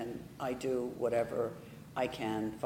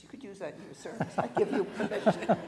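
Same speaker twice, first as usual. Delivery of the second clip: higher voice breaking into laughter; laughing joke; 6 semitones higher, faster than usual. Real, not acted. Church member offers pastor her line, laughing. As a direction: toss it out teasingly through a laugh.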